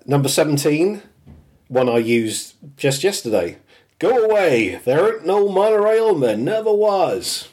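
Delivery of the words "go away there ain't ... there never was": high, pushy "go away" brush-off; mock official brush-off; insistent denial. Quoting the monorail denial.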